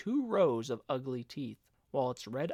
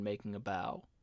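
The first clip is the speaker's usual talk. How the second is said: ordinary delivery, nothing marked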